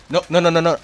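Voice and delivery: loud, sharp panicked cries. Real, not acted. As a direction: shout no as the enemy closes in. About to be killed in game.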